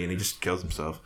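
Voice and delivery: ordinary talk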